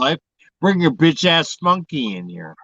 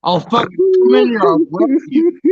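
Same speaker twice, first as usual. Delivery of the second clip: angry threat; 8 semitones higher, 4 dB louder than usual. Real, not acted. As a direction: bark the threat in a raised voice. Troll threatening the streamer.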